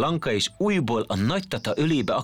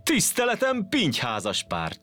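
mock formal greeting; 9 semitones higher than usual. A narrator's reading. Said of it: "csapta össze a bokáját".

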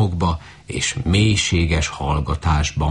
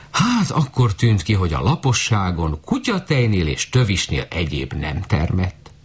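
usual speaking voice

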